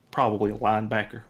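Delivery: normal talking voice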